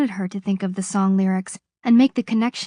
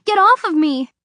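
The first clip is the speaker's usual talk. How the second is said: shouting; 8 semitones higher, 7 dB louder than usual. A narrator's reading. Wrenching free from guard.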